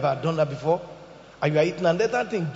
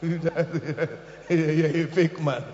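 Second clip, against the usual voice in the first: laughing mock; 2 semitones lower, 5 dB quieter, much slower than usual. Real, not acted. Mocking, laughing.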